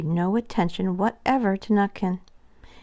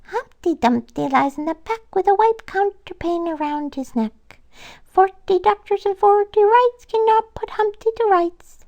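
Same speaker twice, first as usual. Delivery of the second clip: high squirrel voice, building to a louder shout; high teasing squirrel, louder at the end; mock shouting rhyme; 13 semitones higher, 3 dB louder than usual. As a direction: call out the rhyme, cheeky and gleeful. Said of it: "But Nutkin ran in front laughing and shouting".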